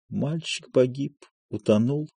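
soft, hushed grief; a quiet weeping lament; understated tearful mourning; quietly crying. A narrator's reading. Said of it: "Любовь Андреевна тихо плачет".

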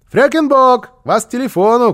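loud, high-pitched shout through a door; calling out. A narrator's reading. Boy calls housekeeper to the phone, calling out loudly. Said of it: "крикнул он".

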